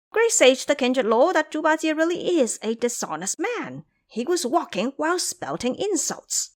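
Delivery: lightly voiced monkeys rushing in to tell; tattling little monkeys. A narrator's reading. Little monkeys hurrying to report Ba Jie's insults.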